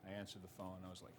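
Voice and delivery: normal talking voice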